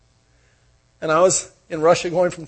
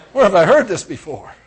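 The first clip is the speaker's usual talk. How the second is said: laughing it out louder; amused, louder retelling with laughter; louder laughing repeat; 3 semitones higher, 5 dB louder than usual. Retold or imitated. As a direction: throw the line again through a laugh. Repeats line, laughing harder.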